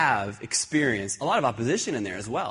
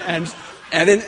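laughing; 4 semitones higher, 8 dB louder than usual. Real, not acted. Laughs retelling the chaos.

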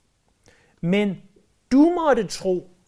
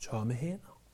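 "Tomme hænder" dropped much softer and lower, almost to himself; hushed answer; 7 semitones lower, 12 dB quieter than usual. Shown as a demonstration. Pastor answering his own question to the congregation, hushed and low. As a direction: let "Tomme hænder" fall away softly and low.

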